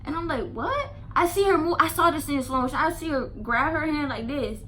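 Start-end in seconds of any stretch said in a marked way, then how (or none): 0.5-0.9 high startled rise on what; a high squeak of disbelief. Retold or imitated. To the girl accusing her, a startled high what.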